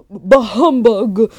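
loud, slow hammed-up grump; mock a huffy churchgoer, high and loud; grumbling churchgoer. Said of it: "storm out of a church".

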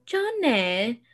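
Moira Rose calling Johnny with a lilting rise; drawn-out theatrical call of Johnny; a light take on Moira Rose; Catherine O'Hara impression. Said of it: "my Catherine O'Hara impression".

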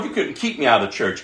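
normal talking voice

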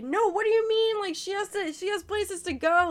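high, loud indignant protest; retold anger. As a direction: re-enact getting mad at someone. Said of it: "I'm getting mad".

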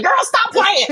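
high, loud mock protest; playful disbelief. Telling her friend she can't believe it, high playful disbelief. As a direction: exclaim it like you caught your girl teasing.

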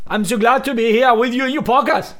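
high, loud imitation Brummie; hammed-up Birmingham accent. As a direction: imitate a hard-to-follow northern English accent. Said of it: "Y, y les imito".

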